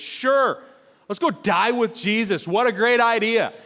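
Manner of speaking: mock the idea like a moody teenager; dripping with sarcasm. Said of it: "dripping with sarcasm".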